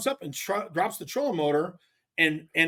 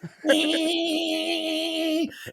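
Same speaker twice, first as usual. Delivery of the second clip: long, high, steady "eeee" whine; imitated boat whine; 9 semitones higher than usual. Angler imitating his slow boat running, high sustained whine. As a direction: hold a high motor whine. Imitated noise after "I'm just.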